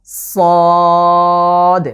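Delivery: long, thick, full-voiced সদ; Arabic letter sad. Shown as a demonstration. Teacher demonstrating the letter to viewers, a loud drawn-out সদ. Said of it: "লাগিয়ে আগার দিকে স্পর্শ করে আমরা উচ্চারণ করব".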